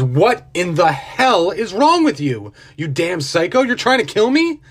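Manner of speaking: outraged after nearly drowning. A narrator's reading. Man accuses the millionaire of trying to kill him, outraged. Gasping man confronts his attacker.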